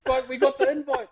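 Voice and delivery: high-pitched, hyped-up retold phone call; rally the bros with big news; excited rallying. Fan phones his mates about the invite, hyped.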